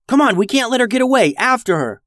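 high, fast urgent call; rally them to chase. Acted. Boy urges sisters to chase.

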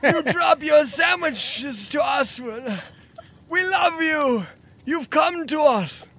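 worshipping cultist. Acted. GM voices worshipping cult people.

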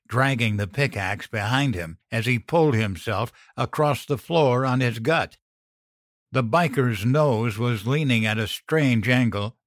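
plain reading voice

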